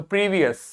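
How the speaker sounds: "प्रीवियस" with a short uh before the s; schwa in last syllable. Shown as a demonstration. Teacher recalling the schwa symbol, "प्रीवियस" with reduced last vowel. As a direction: keep the end of "प्रीवियस" weak. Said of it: "इसका pronunciation मैंने क्या बताया था".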